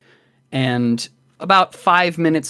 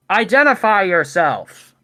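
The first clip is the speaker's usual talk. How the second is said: raised, higher guard's challenge; distant wall guard; 6 semitones higher, 3 dB louder than usual. Acted. Guard challenging travellers from atop the wall, calling out. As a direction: call down a challenge from high above. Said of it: "some voices distant, high above you".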